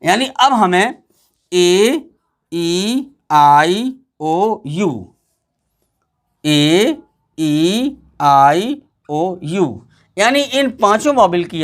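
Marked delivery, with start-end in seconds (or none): 1.5-5.1 ए, ई, आई, ओ, यू each held long, lower pitched; long vowel sounds. Shown as a demonstration. Teacher recites English vowels for pupils, long vowel sounds. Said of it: "long sound यानी लंबी आवाज़ वाला". 6.4-9.9 ए, ई, आई, ओ, यू each held long, lower pitched; long vowel sounds. Shown as a demonstration. Teacher recites English vowels for pupils, long vowel sounds. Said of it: "long sound यानी लंबी आवाज़ वाला".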